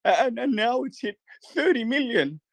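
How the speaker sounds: high, slow mock influencer, hammed up; high mock scam influencer voice. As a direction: play a scammy promoter luring followers. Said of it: "or my scam influencer voice".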